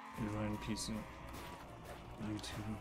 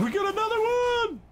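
high, loud laughing cheer; excited laughing shout; 6 dB louder than usual. Real, not acted. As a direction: laugh it out, thrilled. Another player joins, laughs.

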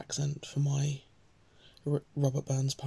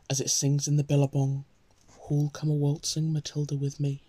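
louder, projected poem reading; declaimed verse, louder than chat; poem recitation; 4 dB louder than usual. Acted. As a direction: recite a bush ballad out to the listeners. Reads the poem aloud.

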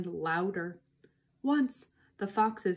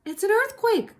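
high, loud cry of alarm; 13 semitones higher, 8 dB louder than usual. A narrator's reading. Missus Fox warning her family, high loud cry. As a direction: cry out as if the ground is moving. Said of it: "cried Missus Fox".

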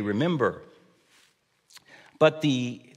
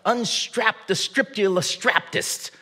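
higher mock command called back, not yelled; called-out captain order; 8 semitones higher than usual. Dad calls a nonsense order to the kids, mock captain. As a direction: call the silly order to the back seat. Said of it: "I called back and said".

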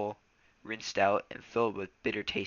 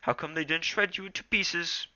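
Smudge voice; 8 semitones higher than usual. Acted. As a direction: voice Smudge higher than narration.

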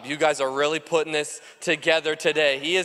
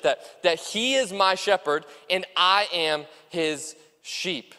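ordinary talk

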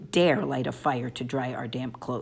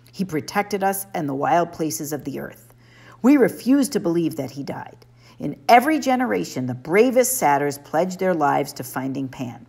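usual speaking voice

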